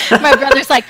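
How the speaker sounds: ordinary talk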